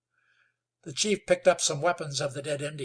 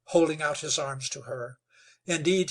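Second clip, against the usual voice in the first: usual speaking voice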